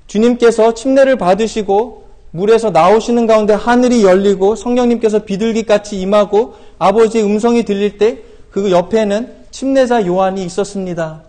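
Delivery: ordinary talk, nothing marked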